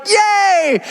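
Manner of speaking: high, bright little cheer; mock cheer. Disciples spotting the beach, a high mock cheer. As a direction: squeal it like kids on a trip.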